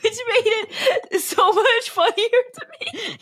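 high, laughing through the words; can barely speak from laughing; laughing to tears. Real, not acted. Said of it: "Are you tearing up?".

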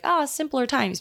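high, wistful mock sigh; mock nostalgia. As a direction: imitate someone pining for the old days. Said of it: "and be like".

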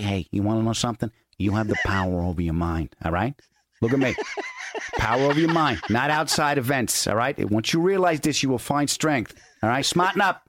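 a light Italian crime boss talking tough; mildly voiced mob boss giving advice; hint of a Brooklyn mobster lecturing. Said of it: "in the voice of a crime boss".

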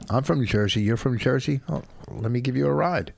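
ordinary delivery

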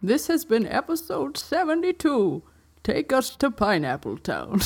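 put-on creaky old man finally getting his lines right; slow old professor outro. Host praises old Cockley's slow careful outro reading. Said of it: "That was, that was good".

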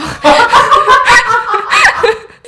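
loud, high burst of real laughter; big laugh. Real, not acted. Teller cracking up at the composer joke, real laughter. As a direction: crack up helplessly.

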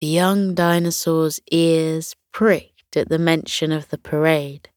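plain reading voice

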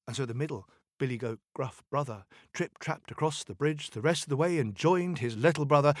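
ordinary delivery

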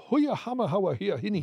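high, sing-song mock Geordie; Geordie impression. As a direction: babble it in a caricatured Geordie lilt. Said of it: "the Geordie accent".

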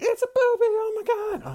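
loud, very high imitation of excited kids; shrieking kids. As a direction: squeal it like a classroom erupting. Acting out shrieking schoolkids.

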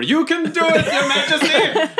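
high, strained yell of encouragement; cheering yell. Acted. Player's character yells over to Goblin Junior, high cheering yell. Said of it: "I yell over".

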